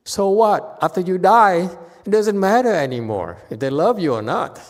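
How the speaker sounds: loud, high, emphatic exclamation; booming preacher's retort; forceful preaching. Real, not acted. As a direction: hammer the point home to the crowd.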